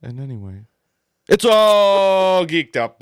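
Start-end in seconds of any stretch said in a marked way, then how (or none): 1.3-3.0 drawn-out, much louder and higher show title; show title shout. Acted. Host bellowing the show title to listeners, long and loud. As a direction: belt the show name out slowly like an announcer.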